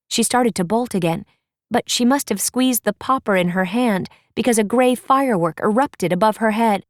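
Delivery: ordinary delivery, nothing marked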